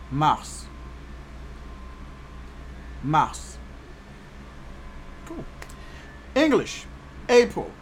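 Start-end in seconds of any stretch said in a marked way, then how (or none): 0.1-3.6 mars said as mahss, ending on a long S; French pronunciation. Shown as a demonstration. Teacher giving learners March in French, mars as mahss. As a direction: model mars the French way, twice.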